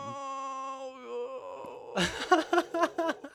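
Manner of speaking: high-pitched squealing laughter; long high laugh. Real, not acted. Co-host cracking up over the soundboard request, high laugh.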